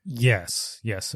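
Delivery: ordinary delivery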